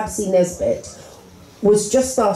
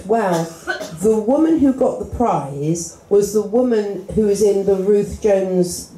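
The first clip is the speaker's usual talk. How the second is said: ordinary talk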